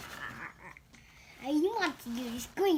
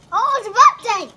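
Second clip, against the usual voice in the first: loud, high excited cry; 6 semitones higher, 15 dB louder than usual. Real, not acted. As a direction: call out in delight. Child excited at eruption.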